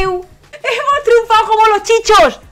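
high, loud victory yell; triumphant shout. Real, not acted. Streamer celebrating success with his viewers, triumphant shout. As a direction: crow it at full volume. Declaring triumph after the pull.

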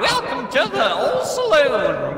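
high, loud hollering cowboy voice; cowboy impression. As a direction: holler like a rowdy saloon cowboy. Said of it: "My cowboy impression".

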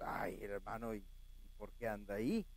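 lightly voiced disapproving brother; a hint of a scandalized churchgoer; mock scandalized brother. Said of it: "me veía ir".

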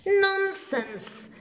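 high, sharp scolding; high stern scolding. A narrator's reading. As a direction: scold them like a cross mother. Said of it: "Their mother scolded".

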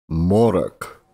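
plain reading voice

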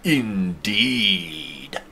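indeed drawn out long in a put-on alien villain voice; terrible Ziltoid impression. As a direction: ham up a bad Ziltoid for one word. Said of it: "Or as Ziltoid would say it".

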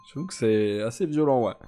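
ordinary delivery

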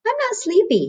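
little bear voice; high, childlike cub voice. A narrator's reading. A cub refusing bed in a high voice.

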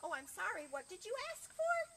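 apology rising into high question; apologise, then lift the question high; puzzled librarian. Acted. Librarian asks Pig to repeat, politely puzzled. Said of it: "children's librarian looked at Pig".

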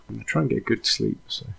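usual speaking voice

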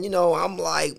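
ordinary talk

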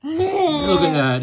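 laughing out the words, voice jumping up. Real, not acted. Parent laughing to child at the picture, laughing loudly. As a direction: crack up while pointing at the page.

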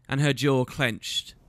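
ordinary delivery, nothing marked

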